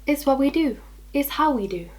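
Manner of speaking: accidentally sassy, lightly tossed-off. Real, not acted. Explaining her odd habit to viewers, sassy. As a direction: give it a little unplanned sass. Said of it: "did not mean to come out sassy".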